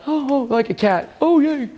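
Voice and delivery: mock-childish glee; childlike delight. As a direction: squeal like a kid keeping a balloon up. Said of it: "you're like".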